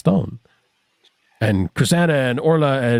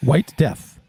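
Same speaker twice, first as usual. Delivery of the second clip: ordinary talk, nothing marked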